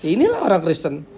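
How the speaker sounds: high-pitched, sing-song scorn; mock dismissive judgement. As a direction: act out someone writing a group off. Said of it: "Berarti dia udah kayak anggap". (nada tinggi mencibir)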